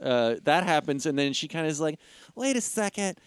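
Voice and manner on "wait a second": high, mock-dawning realization. Heroine figuring it out.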